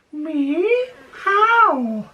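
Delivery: high, wailing meow turned into words; meowing cat. Acted. The cat answers dad back, high meow. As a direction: stretch the words into a cat's meow. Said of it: "The cat answered back".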